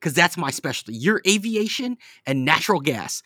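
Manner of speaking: high mocking impression of a smug friend; mocking imitation. Mimicking friend's bragging.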